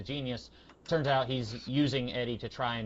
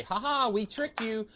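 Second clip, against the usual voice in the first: higher-pitched teasing gotcha; mock gloating; 8 semitones higher than usual. Reader mimics writers pulling the rug out, mock gloating. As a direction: imitate a smug trickster, pitched up.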